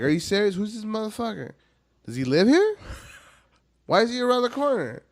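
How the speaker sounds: mock kid outrage. Little kid interrogating his parents about El Cucuy, high mock outrage. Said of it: "I was like".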